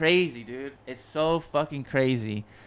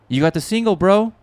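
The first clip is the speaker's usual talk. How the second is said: higher, louder imitation of excited news; excited news-breaking; 7 semitones higher, 6 dB louder than usual. Producer telling him he got the single, excited imitation. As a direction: break the big news to your boy, voice lifting.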